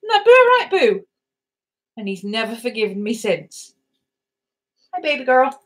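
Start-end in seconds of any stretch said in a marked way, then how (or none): 0.1-1.1 much higher, louder coo; squeaky loving pet talk. Real, not acted. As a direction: coo it at the dog. Cooing to the dog.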